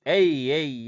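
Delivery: ordinary delivery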